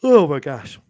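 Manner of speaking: said through a yawn. Real, not acted. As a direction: yawn while speaking.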